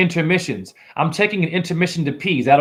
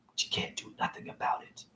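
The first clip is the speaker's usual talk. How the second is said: conspiratorial whisper, softer; 18 dB quieter than usual. Acted. To his online critics, a secretive gloating whisper. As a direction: lean in and breathe it like a secret. Secret boast about the children.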